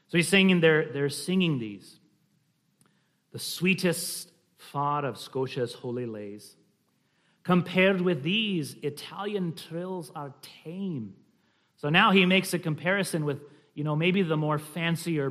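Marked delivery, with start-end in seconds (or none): 3.3-11.1 slow Scottish poetry reading; slight Scottish accent. Shown as a demonstration. Reading of Scotia's holy lays, slight Scottish accent. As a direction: savour the lines in light Scots. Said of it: "a slight Scottish accent".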